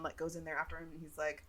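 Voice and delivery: usual speaking voice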